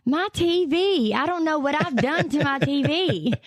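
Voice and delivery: put-on high, fretful old lady; old grandma voice. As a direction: whine like a helpless granny. Imitating grandma's daily calls.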